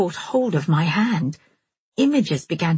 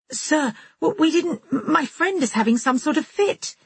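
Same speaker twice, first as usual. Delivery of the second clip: high, stumbling, scared explanation; frightened stammer; 9 semitones higher than usual. A narrator's reading. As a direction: stammer it out in panic. Stammering to angry shopkeeper.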